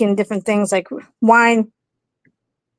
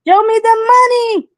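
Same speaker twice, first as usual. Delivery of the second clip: high, loud exclaimed catchphrase; excited movie quote; 13 semitones higher, 3 dB louder than usual. Retold or imitated. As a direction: belt it out like a famous line. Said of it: "as Tom Cruise said".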